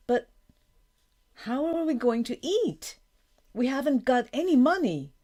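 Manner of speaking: high-pitched, anxious appeal; worried protest. A narrator's reading. Daughter challenging her father about money, worried. Daughter upset at losing livelihood.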